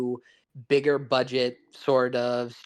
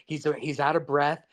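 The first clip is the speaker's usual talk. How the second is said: ordinary talk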